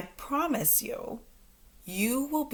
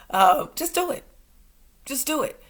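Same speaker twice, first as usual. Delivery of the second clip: normal talking voice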